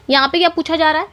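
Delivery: ordinary talk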